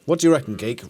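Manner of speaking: ordinary talk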